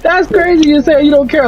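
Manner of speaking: high-pitched, offended mock of the coworker; indignant coworker. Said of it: "She's like".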